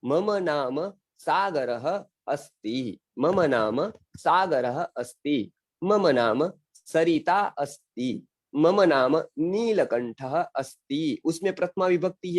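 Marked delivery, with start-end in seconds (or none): 0.0-3.0 very slow, careful "मम नाम सागरः अस्ति" with a full "मम"; slow model sentence. Shown as a demonstration. Teacher dictates the sentence slowly to the class. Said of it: "मम में म आ पूरा कर दीजिए". 3.2-11.2 slow dictation. Shown as a demonstration. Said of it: "विसर्ग के साथ बोलना है".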